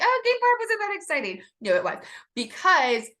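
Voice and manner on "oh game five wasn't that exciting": high, mock-dismissive quote of her own words. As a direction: toss it off high and breezy, then take it back. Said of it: "I was like".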